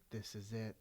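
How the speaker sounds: slow, soft, low self-reproach; hushed thought in a slow-motion moment; inner self-scolding. Retold or imitated. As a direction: curse yourself quietly, stretching the words out. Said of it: "in my mind where I was like".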